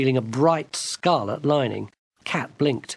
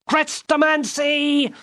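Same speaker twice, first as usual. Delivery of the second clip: high, slow bawl of a name; girl bawling for the enchanter on the staircase; 14 semitones higher, 3 dB louder, slower than usual. Acted. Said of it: "bawled Gwendolen".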